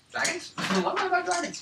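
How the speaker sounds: high, laughing disbelief; incredulous. Real, not acted. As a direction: squeak it in disbelief.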